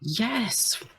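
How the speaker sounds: high, soft drawn parental yes; exasperated promise. Acted. Dad promising his son at bedtime, high and soft. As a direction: concede it high and quiet.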